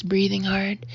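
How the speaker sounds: usual speaking voice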